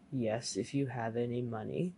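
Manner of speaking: low, hushed ghoul voice; sinister dead men. Acted. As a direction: answer low and soft, like the dead. The dead men answer the youth.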